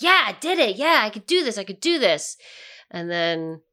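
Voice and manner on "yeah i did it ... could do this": triumphant. Retold or imitated. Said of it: "I was like".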